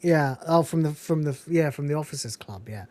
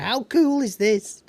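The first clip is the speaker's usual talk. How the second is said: slow, higher mock enthusiasm; retold excitement; 7 semitones higher, 3 dB louder, slower than usual. Lister showing off his plastic pineapple, mock excited. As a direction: draw it out as a lightly acted show-off. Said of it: "Lister's got this plastic pineapple".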